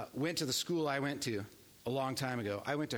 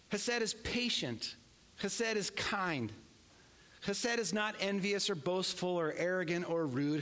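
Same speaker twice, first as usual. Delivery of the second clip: solemn recitation; 4 semitones higher than usual. Acted. Recites love passage slowly.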